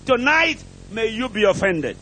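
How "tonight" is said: normal talking voice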